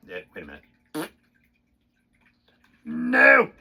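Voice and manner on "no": loud, high yelp of "No!"; a startled cry at a mistake; sudden shout. Real, not acted. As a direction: blurt it out sharply. Sudden outburst while playing.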